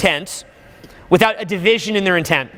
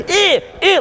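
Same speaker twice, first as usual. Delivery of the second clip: high, loud, expansive yelp; wild outburst; 12 semitones higher, 4 dB louder than usual. Shown as a demonstration. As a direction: burst out with crazy energy. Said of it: "like that?".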